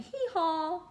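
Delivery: high, loud hee-haw bray; donkey bray. Shown as a demonstration. Donkey page, son likes it.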